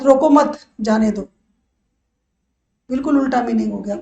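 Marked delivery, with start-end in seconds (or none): 0.0-1.2 रोको मत together, pause, then जाने दो dropping away; pause after मत. Shown as a demonstration. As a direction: attach मत to रोको, break before जाने दो. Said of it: "हमने कोमा लगा दिया गलत". (रोको मत के बाद विराम, फिर जाने दो)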